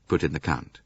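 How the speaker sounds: ordinary delivery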